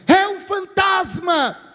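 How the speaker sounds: high, slow terrified shout; frightened shout. Acted. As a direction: scream it as if seeing a ghost. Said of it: "todo mundo grita assim".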